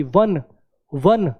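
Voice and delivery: वन with a clear w opening; वन said slowly as "wun"; w sound demo. Shown as a demonstration. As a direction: stress the w in वन. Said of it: "लेकिन sound कैसी है? व की".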